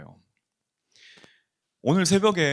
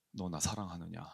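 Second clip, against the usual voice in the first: much softer, hushed voice of Jesus; tender question; 5 semitones lower, 11 dB quieter than usual. Acted. Jesus asking his follower, soft and tender. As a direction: ask it gently, almost under the breath.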